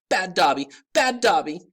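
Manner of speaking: high, loud self-scolding shout; shouting elf. A narrator's reading. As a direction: yell it while punishing yourself.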